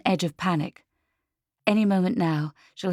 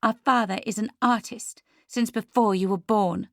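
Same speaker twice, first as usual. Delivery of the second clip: higher, mildly indignant defence; hot retort; 6 semitones higher than usual. A narrator's reading. Leah tells her sister about their father, mildly hot retort. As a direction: defend him with a touch of heat. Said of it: "Leah replied hotly".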